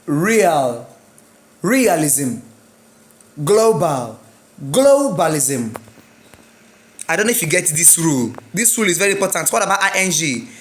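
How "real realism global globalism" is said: "Real", "Realism", "Global", "Globalism" with strong, stretched first syllable; stress on first syllable. Shown as a demonstration. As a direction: punch RE in "Realism", GLO in "Globalism". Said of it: "rules for syllabic stress".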